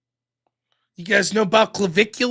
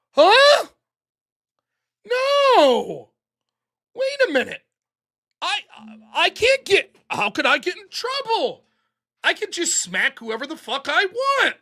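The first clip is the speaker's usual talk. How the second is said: higher, sudden squeaky indignation; mock entitled woman; 7 semitones higher than usual. Streamer voicing the arrested woman, mock entitled shock. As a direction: play her as stunned and entitled.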